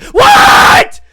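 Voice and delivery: huge high-pitched "what"; shocked shout. Retold or imitated. Coworker at work hearing the revelation, shocked high shout. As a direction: blast out your stunned reaction. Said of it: "I was shocked".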